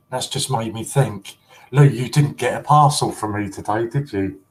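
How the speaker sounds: usual speaking voice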